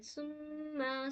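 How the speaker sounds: सुम्मा slowly, doubled m held through the nose; nasal ghunna. Shown as a demonstration. Teacher modelling ghunna on सुम्मा for students. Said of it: "सुम्मा में गुन्ना करेंगे".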